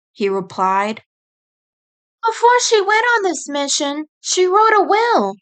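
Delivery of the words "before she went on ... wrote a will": put-on high young female rabbit voice, earnest; turning serious as the sister. Sister turns serious with Nick, high earnest voice. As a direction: get down to business. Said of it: "she began".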